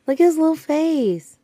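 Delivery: high, gushing coo; overwhelmed by cuteness. Real, not acted. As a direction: coo over a cute puppy. Seeing the puppy, cooing.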